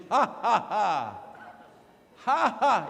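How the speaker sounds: loud, deliberate "ha ha ha"; hammed-up laugh, each ha punched out; demonstrated fake laugh. Shown as a demonstration. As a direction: show them a big forced laugh. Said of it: "I wanna see one of these".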